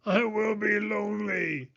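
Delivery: high shouted plea; shouting. Acted. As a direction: shout it out, afraid of being alone. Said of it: "he shouted".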